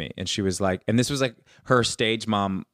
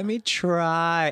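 slow hopeful try; 5 semitones higher, much slower than usual. Retold or imitated. Trying to feel safe with mom.

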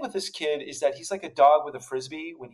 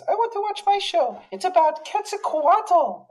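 imitating his kid; 4 dB louder than usual. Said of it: "He goes, he goes".

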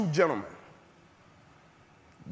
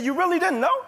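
much higher, rising disbelieving question; acted incredulity; 9 semitones higher than usual. Nurse presses the unknowing patient, acted astonishment. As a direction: play the nurse astonished he has no idea.

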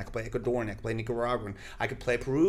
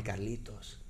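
grandmother; 3 semitones lower, 9 dB quieter than usual. Retold or imitated. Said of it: "my grandma always used to tell me".

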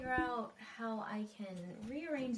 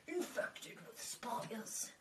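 slow, hushed whisper; 5 dB quieter, slower than usual. Real, not acted. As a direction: breathe it out slowly. Joking aside about office.

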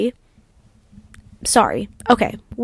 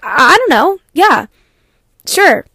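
higher, flustered, rising with each word; 4 semitones higher, 4 dB louder than usual. Retold or imitated. To the pedicurist asking if she's an athlete, flustered and higher. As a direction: stammer it out, caught off guard and unsure.

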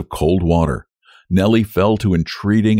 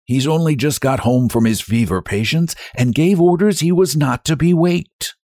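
higher-pitched cook's voice; whispering cook; 7 semitones higher than usual. A narrator's reading. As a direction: explain as the wary servant, pitched up. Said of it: "as though afraid of waking her master".

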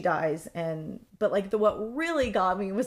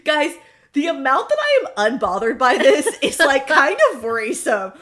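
laughing excitement; 4 semitones higher, 9 dB louder than usual. Real, not acted. Gleeful shift to funny news.

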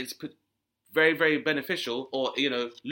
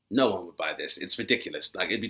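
lower, sly designer voice; low scheming voice; 7 semitones lower, 3 dB quieter than usual. Retold or imitated. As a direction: scoff it low. Fashion house mocking buyers.

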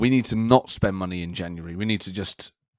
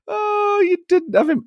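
laughing. Real, not acted. Laughs at rival missing Salah.

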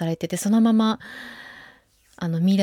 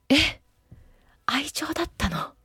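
hushed breathless whisper of disbelief; shocked realization; 7 semitones higher, 6 dB quieter than usual. Retold or imitated. Fan reliving the film's twist in a stunned whisper. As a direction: gasp it softly as the truth sinks in. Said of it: "みたいな衝撃が".

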